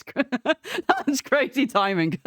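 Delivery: giggling through the words; can't stop laughing at the timing. Real, not acted.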